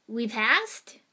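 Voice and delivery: loud question shooting up at the end; incredulous, rising query; disbelief. A narrator's reading. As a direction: ask it as if you can't believe it. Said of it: "disbelief coloring his voice".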